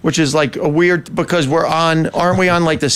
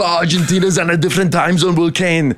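higher-pitched comic character voice; put-on bit voice; 5 semitones higher than usual. Shown as a demonstration. Comedian showing the host what a bit would sound like, put-on voice. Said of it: "do it in a different voice".

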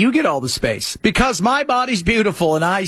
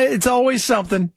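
usual speaking voice

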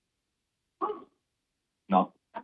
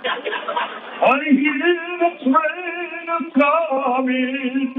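hammed-up Italian accent, fast then sung high; mock Italian accent, "the train a-coming" sung much higher; 9 semitones higher than usual. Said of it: "in our heritage".

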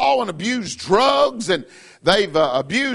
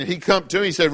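ordinary talk, nothing marked

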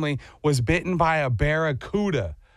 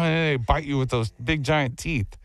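ordinary delivery

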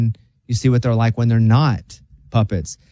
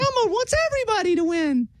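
Elmo voice; 6 dB quieter than usual. Retold or imitated. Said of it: "mad at Elmo because".

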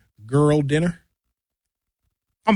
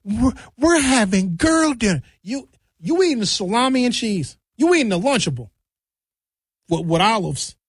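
mocking girly voice; 4 semitones higher than usual. Mocking girls at dinner.

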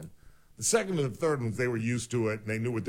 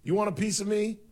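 put-on tough kid; 10 semitones higher than usual. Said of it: "what did he tell the cop".

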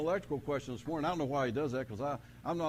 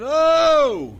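loud, high shocked "Oh!"; startled yell; 13 semitones higher, 18 dB louder than usual. Retold or imitated. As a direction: yell as if the shock just hit. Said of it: "I yelled".

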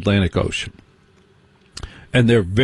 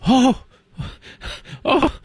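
very high mock-shocked "oh, oh"; hammed-up scandalized preppies; mock shocked gasp. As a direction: gasp in fake horror, way up high.

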